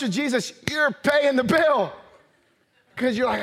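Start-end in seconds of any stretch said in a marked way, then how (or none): 0.7-1.9 higher, slow and drawn-out exclamation; emphatic insistence. Real, not acted. Preacher telling listeners who pays at lunch with Jesus, emphatic. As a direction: drive it home with a raised voice.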